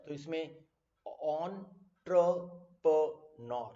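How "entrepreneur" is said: entrepreneur very slowly as ON... TRUH... PUH... NAR; syllable by syllable. Shown as a demonstration. Teacher drilling the class, entrepreneur split ON, TRUH, PUH, NAR. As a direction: break entrepreneur into four louder, separate beats. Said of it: "pronunciation stress देने वाली बात है".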